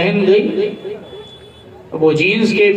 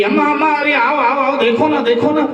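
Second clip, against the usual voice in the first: high, excited sing-song calling; 6 semitones higher than usual. Acted. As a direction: call her over, thrilled with the butter. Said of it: "तो एकदम चिल्लाती हुई गई". (उत्साह से ऊँची सुरीली पुकार)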